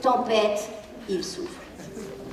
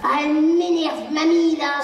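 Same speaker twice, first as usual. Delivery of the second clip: higher, lightly fed-up voice that settles into the decision to breathe; mock annoyance; 5 semitones higher, 3 dB louder than usual. Shown as a demonstration. Parent showing the child how to handle annoyance, mildly mock annoyed. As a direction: show the child you're annoyed, then calm. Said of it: "on dit à haute voix ce que nous faisons".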